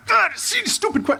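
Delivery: high, nervous imitation of a starstruck fan. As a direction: squeak it out like a fan losing it. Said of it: "he's just freaking out like".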